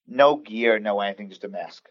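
normal talking voice

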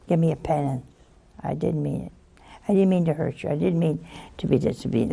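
put-on soft, low sorry child; contrite apology. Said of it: "you should say".

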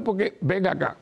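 ordinary talk

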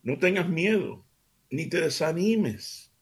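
slow, earnest Bible reading; scripture reading. Acted. Host reads God's words to his radio family, earnest.

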